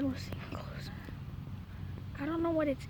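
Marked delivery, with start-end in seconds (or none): 0.0-0.9 soft whisper. Real, not acted. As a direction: barely breathe the words. Sneaking toward a bird.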